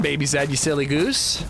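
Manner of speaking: ordinary talk, nothing marked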